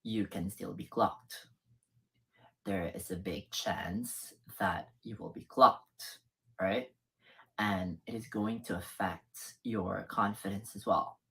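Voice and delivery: the deep voice she warns against. Shown as a demonstration. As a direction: sink right down to a deep masculine pitch. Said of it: "or as deep as this".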